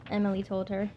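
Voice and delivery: plain reading voice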